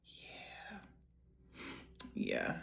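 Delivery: breathy whispered aside; hushed private aside. Real, not acted. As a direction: lean in and whisper it. Hushed reaction.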